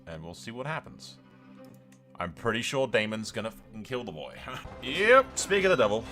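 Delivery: ordinary talk, nothing marked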